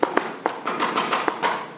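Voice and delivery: startled, drawn-in gasp; sharp gasp. Acted. Storyteller reacting to tiger arriving, startled gasp. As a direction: gasp as if caught off guard.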